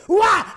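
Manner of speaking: loud high yelp as the plane shakes; hammed-up turbulence scream; mock scream. Plane jolts.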